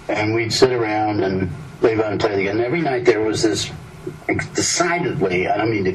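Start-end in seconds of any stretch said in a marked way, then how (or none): none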